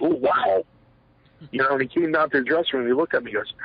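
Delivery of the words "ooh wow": high, impressed ooh wow; imitated amazed gasp. Boss watching a wrestler's slingshot, imitated amazed ooh. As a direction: mimic the boss caught off guard. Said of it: "Vince himself went".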